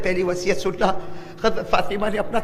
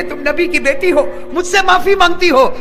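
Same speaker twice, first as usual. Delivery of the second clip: anguished cry; 8 semitones higher, 6 dB louder than usual. Acted. Ali anguished at her plea.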